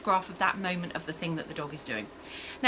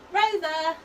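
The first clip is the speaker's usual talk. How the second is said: calling the name; 7 semitones higher, 5 dB louder than usual. Shown as a demonstration. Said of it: "I'm gonna call the name".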